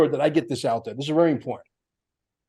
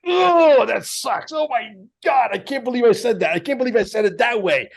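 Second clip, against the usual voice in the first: mock cringing at himself; 4 semitones higher than usual. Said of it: "I'd be like".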